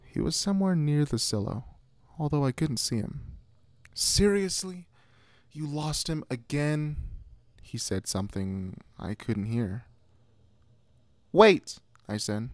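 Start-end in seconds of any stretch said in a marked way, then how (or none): 3.9-7.0 high-pitched exasperated call. A narrator's reading. Sister to brother who lost the dog, exasperated call. 11.3-11.8 loud, high shout; calling out. A narrator's reading. Sister calling to brother near the silo, shouting.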